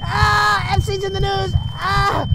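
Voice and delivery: high, loud screams through the words; hammed-up pained screaming; great pain. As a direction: shout it while howling in agony. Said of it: "it gives me great pain".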